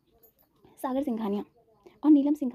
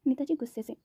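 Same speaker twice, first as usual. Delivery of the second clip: ordinary delivery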